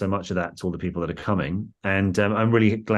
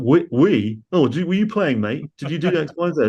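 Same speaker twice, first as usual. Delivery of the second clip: mocking Scouse fan; 7 semitones higher, 4 dB louder than usual. Mocking fans quote.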